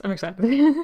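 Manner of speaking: a bright upward lift on "excited". Shown as a demonstration. Streamer showing how excited she is, eager lift. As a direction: sell "excited" with a rising, eager tone. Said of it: "I'm like, I'm excited".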